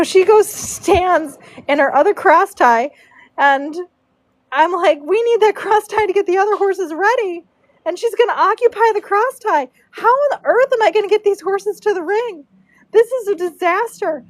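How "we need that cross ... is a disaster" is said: mock panic. Panicked inner voice at the braider.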